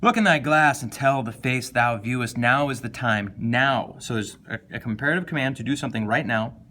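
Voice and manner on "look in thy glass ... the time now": louder, urgent, pressing "Look" and "now"; commanding reading. Shown as a demonstration. Teacher reading the sonnet as an imperative command, louder. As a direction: bark it like an order. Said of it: "We got imperative command".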